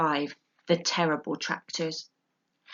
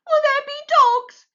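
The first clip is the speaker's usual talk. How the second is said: high, loud scared small fox; frightened child; 10 dB louder than usual. A narrator's reading. Small fox asking his mother about death, frightened. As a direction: ask it wide-eyed with fright. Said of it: "huge with fright".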